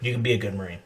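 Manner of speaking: low, soft retold instructor; kill hat's send-off. Kill hat handing over the emblem, low quiet send-off. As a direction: send off a recruit quietly, voice dropped. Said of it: "that shit made me cry because he's like".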